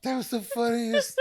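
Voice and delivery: high, wheezing laughter through words; helpless laughter, barely speaking; laughing. Real, not acted. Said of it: "I can't stop laughing".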